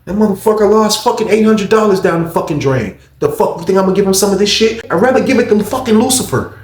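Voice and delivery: high, loud heated ranting; imitated angry rant. Guard snapping about the roommate, high loud imitated rant. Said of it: "He was just snapping".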